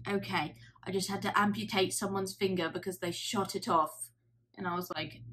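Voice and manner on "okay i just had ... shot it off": casual imitated South African accent; lightly voiced South African surgeon. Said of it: "doing his accent".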